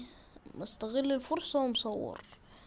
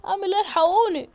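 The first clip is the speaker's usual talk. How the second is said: higher, louder cry for help; panicked plea; 7 semitones higher, 9 dB louder than usual. Real, not acted. Gamer surrounded by monsters, crying out for help. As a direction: call for rescue in a panic.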